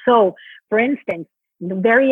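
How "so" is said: normal talking voice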